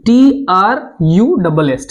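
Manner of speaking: "T R U double S" letter by letter, drawn out; spelling out slowly. Shown as a demonstration. Teacher spelling the truss term for students, slowly, letter by letter.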